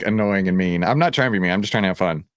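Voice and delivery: ordinary delivery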